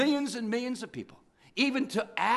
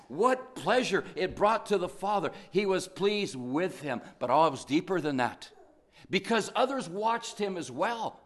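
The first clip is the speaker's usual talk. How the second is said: ordinary talk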